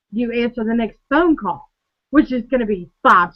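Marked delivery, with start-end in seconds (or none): none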